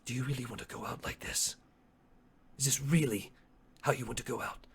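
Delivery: soft, grave questioning with pauses; quiet challenge in character. Acted. Chotuku asks Asteri about his end, hushed and grave. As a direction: challenge your foe in a hushed voice.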